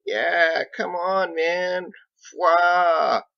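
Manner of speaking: high, loud mock plea ending in a scoffing pwah; impersonation of Ditch. Mason mimicking Ditch to tease Captain, loud and high. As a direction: ham up Ditch's appeal to common decency. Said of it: "an unmistakable impersonation of Ditch".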